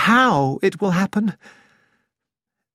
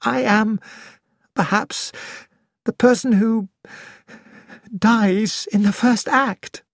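dreamy voice; 6 semitones higher than usual. Acted. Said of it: "it was in a dreamy voice".

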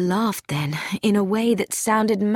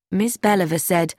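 ordinary delivery, nothing marked

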